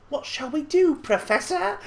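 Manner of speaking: a Harry Potter-style English voice actor; posh British accent. Retold or imitated. Imitating the English voice cast of a horror game, posh British accent. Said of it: "very weird Harry Pottery kind of".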